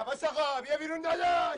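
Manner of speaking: angry shout at the neighbour's door; calling out. Acted. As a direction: shout at the door for him to come out.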